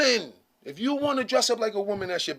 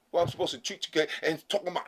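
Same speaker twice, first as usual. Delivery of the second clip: ordinary talk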